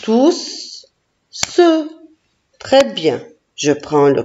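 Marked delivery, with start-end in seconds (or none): 0.0-1.9 "Tousse, se" broken into two separate beats, the second higher; syllable-by-syllable "Tousse … se"; split into syllables. Shown as a demonstration. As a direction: sound out "Tousse", pause, then a raised "se". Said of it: "fais le découpage syllabique du mot tousse".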